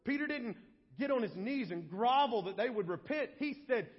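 ordinary talk, nothing marked

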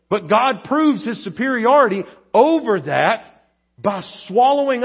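preacher building to a climax; climactic preaching. Acted. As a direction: hammer the point home to the congregation. Preaching at full force.

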